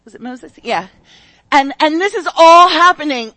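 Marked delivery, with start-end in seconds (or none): 1.5-3.3 loud, driving preacher shout; full-force preaching. Real, not acted. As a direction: throw it out to the whole room.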